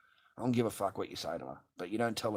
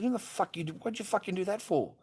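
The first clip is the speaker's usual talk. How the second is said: much higher, exasperated challenge; retold indignant demand; 11 semitones higher than usual. Father replaying how he challenged his partner, indignant. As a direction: replay an angry confrontation, pitch way up. Retelling his angry argument with her.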